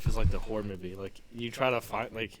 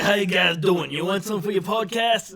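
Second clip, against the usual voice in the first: loud, high New Yorker pitch; pushy New York accent, raised voice; 8 semitones higher, 10 dB louder than usual. Acted. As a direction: holler it as a New York hustler. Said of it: "he speaks like a New Yorker accent".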